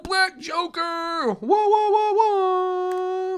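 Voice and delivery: very high, loud, stretched-out whooping; excited shout. Real, not acted. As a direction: crow over the lucky draw at full voice.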